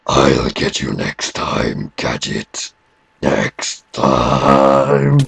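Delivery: slow menacing cartoon villain, very low; Doctor Claw. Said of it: "I do a great impression of Doctor Claw".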